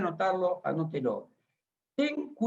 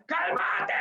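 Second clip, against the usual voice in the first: mock shout; 12 semitones higher than usual. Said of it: "le digo a los gritos pelados".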